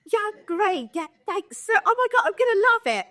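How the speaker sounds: high, forced brightness through pain; mock cheerful high squeak; strained high fake cheer. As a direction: fake being fine in a squeaky voice. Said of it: "I was like".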